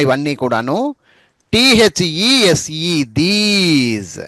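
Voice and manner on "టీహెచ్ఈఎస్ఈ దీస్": slow spelling drill. Shown as a demonstration. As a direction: spell out "టీహెచ్ఈఎస్ఈ" letter by letter very slowly, then say "these". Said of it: "టీహెచ్ఈఎస్ఈ అన్న తర్వాత మళ్ళీ ఒకసారి these అని పలకాలి".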